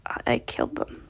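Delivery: broken, hushed whisper; whispered confession. A narrator's reading. Moon confessing to Gregory, ashamed whisper. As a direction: whisper it in shame, barely voiced. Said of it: "held his hands to his face".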